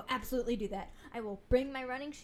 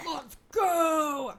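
loud, high rallying yell; gym-bro battle cry; mock hype shout; 4 semitones higher, 11 dB louder than usual. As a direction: holler it like a pumped-up athlete. Joins in as hyped athlete.

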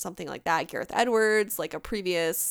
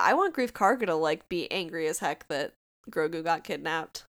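normal talking voice

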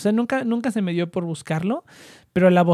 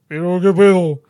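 deep voice imitation; 5 dB louder than usual. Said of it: "una voz de".

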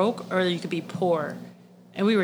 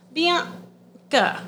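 hesitant naming; 9 semitones higher than usual. Retold or imitated. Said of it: "this hesitation".

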